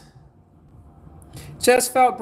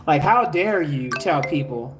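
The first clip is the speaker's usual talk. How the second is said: lower, slow, indignant mimicry of a rival healer; mock angry practitioner; 5 semitones lower, slower than usual. Mimicking salty practitioners who object to free healing, lower and slow. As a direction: play the offended practitioner scolding her, unhurried. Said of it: "heated practitioners gonna be salty with me".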